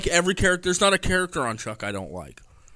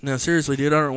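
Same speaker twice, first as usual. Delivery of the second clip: normal talking voice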